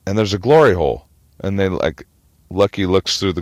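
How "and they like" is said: usual speaking voice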